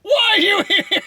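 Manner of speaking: crying-laugh shout. Real, not acted. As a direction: yell it while in stitches.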